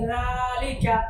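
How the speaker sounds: লালিকা begun with the tongue tip on the teeth edge; touch tongue to teeth for the first sound of লালিকা; tongue-tip pronunciation. Shown as a demonstration. Teacher models the letter, tongue tip to teeth in লালিকা. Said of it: "জিহ্বার আগা দাঁতের আগা লাগিয়ে".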